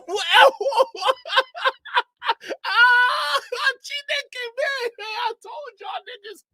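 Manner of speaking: high, loud laughing cries; laughing shriek. Real, not acted. Streamer howling with laughter at the clip, shrieking. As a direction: crack up and shriek the question.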